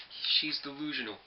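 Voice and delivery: mock Tony's scoff; imitating Tony.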